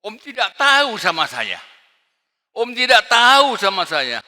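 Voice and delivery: loud, high, boastful shout; loud scornful boast; arrogant rich man. Acted. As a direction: brag it like a proud big shot. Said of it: "Tandanya hati sombong".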